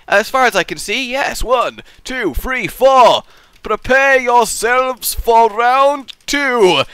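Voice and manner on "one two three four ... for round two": game announcer. Real, not acted. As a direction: build to a big arena-style call. Announces the next round.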